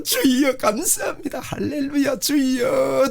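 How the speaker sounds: high, slow, thankful praise; a bright high hallelujah; joyful prayer. Shown as a demonstration. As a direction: lift up thanks to the Lord. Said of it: "그래서".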